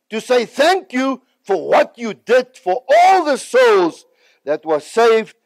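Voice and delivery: loud, slow, rising preacher's cry; preaching. Acted. God telling him to honour Reinhard, slow, loud preaching. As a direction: build it to a slow, loud climax.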